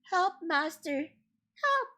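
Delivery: high squeaky cry for help; harp crying out. Acted. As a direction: shriek it like a frightened magic harp. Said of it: "the harp cried".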